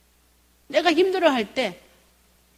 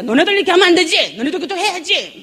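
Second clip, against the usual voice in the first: put-on scolding kids; 6 semitones higher, 4 dB louder than usual. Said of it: "자녀들한테 이럽니다".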